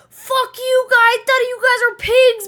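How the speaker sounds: high, loud, slowed imitation of the brother yelling; mock yelling. Brother yelling at police while being dragged down the stairs, mock shout. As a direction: shout it the way he did, dragged downstairs.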